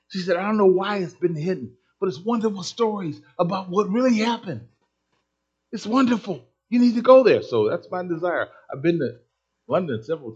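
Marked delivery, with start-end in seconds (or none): 0.4-7.4 climbing higher and louder to the end; excited urging. Retold or imitated. Curator urging the crowd to the museum, excited and building. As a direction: urge them on with rising joy.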